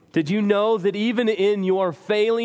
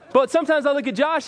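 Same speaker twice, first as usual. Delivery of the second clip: fast, louder, higher with laughter; laughing; 7 semitones higher, 3 dB louder, faster than usual. Real, not acted. Pastor jokingly envying Josh, laughing aloud. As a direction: rush it out laughing.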